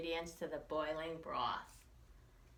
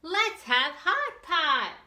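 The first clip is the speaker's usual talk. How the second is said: loud, lively read-aloud with slow punchy refrains; reading to children; 5 semitones higher, 9 dB louder than usual. A narrator's reading. Teacher reading a picture book to children, lively and loud. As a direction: read it big and bouncy for the kids.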